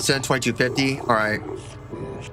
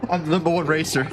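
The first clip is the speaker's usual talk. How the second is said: usual speaking voice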